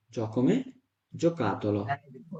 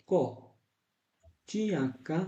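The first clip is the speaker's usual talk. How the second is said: ordinary talk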